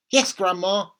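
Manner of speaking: put-on dutiful small boy answering; little boy's voice. A narrator's reading. George answers grandma.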